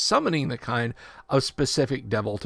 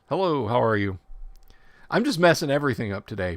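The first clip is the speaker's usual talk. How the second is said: ordinary talk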